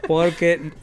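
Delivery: sheepish laughing apology. Real, not acted.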